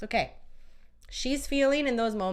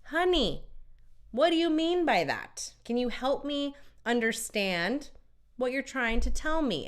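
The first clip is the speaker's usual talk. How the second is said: higher-pitched, coaxing question to a partner; patient partner asking; 6 semitones higher than usual. Acted. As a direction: model asking her to explain, pitched up. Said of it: "ask her".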